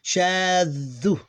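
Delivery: "shaddu" with long vowel and held middle consonant; sustained, unbroken demo of "shaddu"; blended without stopping. Shown as a demonstration. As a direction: draw out "shaddu" with no gap between syllables. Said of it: "this like two".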